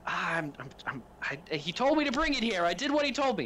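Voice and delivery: high, stammering defence; a scared mechanic blurting it was not his fault; high panicky stammering; panicked stammer. Acted. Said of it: "And Chan goes".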